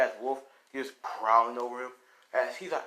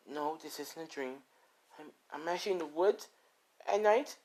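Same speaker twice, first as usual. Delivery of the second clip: a little scared; 5 semitones higher, 4 dB quieter than usual. Acted. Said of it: "As he's a little scared right now".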